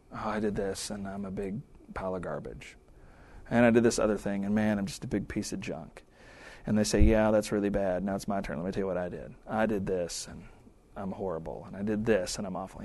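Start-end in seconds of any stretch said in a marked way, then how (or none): 0.1-6.0 mock self-pity. Mocking self-pitying accountability venting. 7.0-12.8 mock self-pity. Mocking partner's venting turn.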